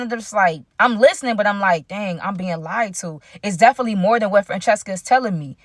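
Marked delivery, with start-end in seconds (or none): 1.9-3.2 slow, soft aside to himself; realizing his friend is lying, soft slow aside; muttered inner thought. Retold or imitated. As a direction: say it under your breath, slowly.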